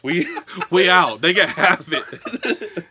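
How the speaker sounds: laughing through the words, slowed down; can't hold back the laugh. Real, not acted. Laughing at own joke.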